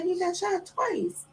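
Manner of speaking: put-on high, slow, soft little boy; child voice. Boy tells mama what the ghost said, high child voice. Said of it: "He said".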